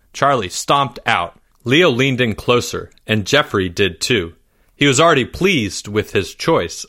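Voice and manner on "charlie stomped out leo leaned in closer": ordinary delivery, nothing marked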